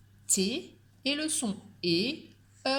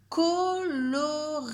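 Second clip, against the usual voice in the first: colorier drawn out slowly, high and a bit louder; slow dictation; 7 semitones higher, 4 dB louder, much slower than usual. Shown as a demonstration. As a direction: stretch out colorier syllable by syllable for the dictation. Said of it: "Vous allez m'écrire le mot".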